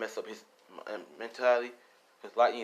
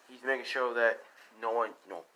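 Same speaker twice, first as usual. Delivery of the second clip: ordinary delivery, nothing marked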